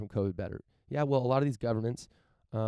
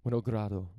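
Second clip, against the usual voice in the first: a hint of a Russian accent on Winogrado; lightly Russian-accented surname; Russian-like pronunciation. Real, not acted. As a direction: say Winogrado with slightly Russian-sounding vowels. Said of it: "that's like Russian. I don't know why I pronounced it that way".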